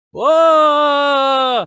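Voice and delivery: long, loud mock scream on one held note; Chris Pratt's scream. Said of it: "the voice that Chris Pratt is using to scream".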